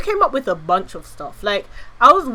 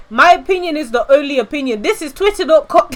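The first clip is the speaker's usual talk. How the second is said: mock arrogant; 4 semitones higher, 5 dB louder than usual. Mock arrogant hot take.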